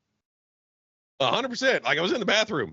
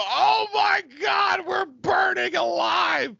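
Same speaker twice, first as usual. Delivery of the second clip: high, slow agonized scream; burning scream; much slower than usual. Acted. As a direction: scream while burning alive. Acted family burning.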